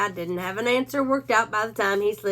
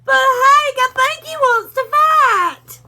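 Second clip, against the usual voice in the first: much higher, louder, slow crying plea; a timid pup whimpering to his boss; scared Drover; 9 dB louder, slower than usual. A narrator's reading.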